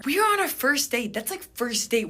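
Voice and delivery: high, loud indignant outburst. Retold or imitated. Woman appalled by date discussing exes, indignant. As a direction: protest in sheer disbelief.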